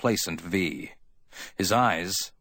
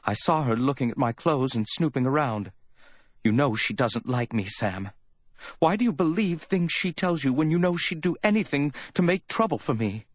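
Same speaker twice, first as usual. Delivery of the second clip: higher, indignant woman's complaint; 8 semitones higher than usual. A narrator's reading. Woman complaining to Sam about Effie, indignant. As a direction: complain with rising pitch and indignation. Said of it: "she said indignantly".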